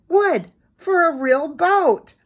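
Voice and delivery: high, drawn-out excitement; slow it down and pitch it up, thrilled; excited. A narrator's reading. Timmy finds wood for a boat, excited.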